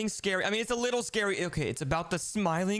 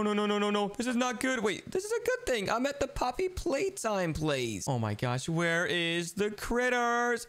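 normal talking voice

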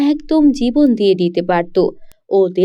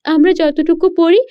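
put-on higher, fast reassuring young girl; eager daughter; 7 semitones higher, faster than usual. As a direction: rush to brush off her worry.

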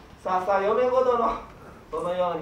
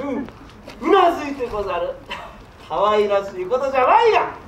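normal talking voice

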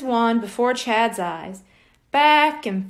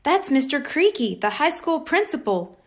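ordinary delivery, nothing marked

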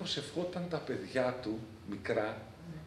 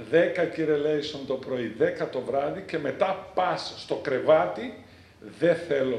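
stern command; 9 dB louder, slower than usual. Retold or imitated. Priest laying down strict orders.

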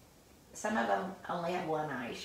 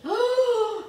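high loud gasp of surprise; loud excited gasp; sharp gasp; 12 semitones higher, 8 dB louder than usual. Acted. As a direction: gasp as if amazed. Storyteller builds suspense.

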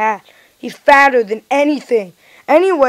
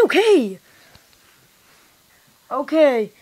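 ordinary talk, nothing marked

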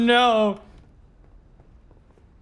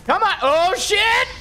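tense outcry; 10 semitones higher, slower than usual. Real, not acted. About to die in game.

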